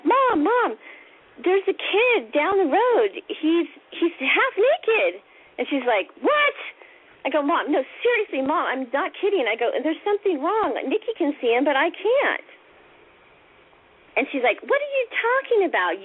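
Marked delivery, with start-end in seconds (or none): 0.0-5.2 high, drawn-out report to Mom; retold kid's urgent insistence; pleading child. 6.2-6.6 very high, rising question; retold sharp disbelief; incredulous mom. Angry mom's incredulous reply. 7.4-12.4 put-on higher-pitched kid's protest; retold pleading to be believed; earnest insisting. Child insisting to doubting mom. 14.7-16.0 high-pitched dismissive question; retold exasperated disbelief; incredulous mom.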